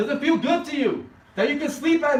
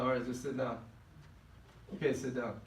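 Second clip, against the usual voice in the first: soft, lower, gentle father; quiet calming; 12 semitones lower, 15 dB quieter than usual. Acted. Father calming his angry son, soft and tender. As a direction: hush him down gently.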